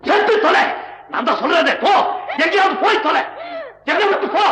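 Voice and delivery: enraged shouting at the wife; furious shout. Acted. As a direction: drive her out screaming. Husband drives his wife away.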